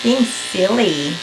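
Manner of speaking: cooing to dog. Real, not acted. Talking to dog while grooming.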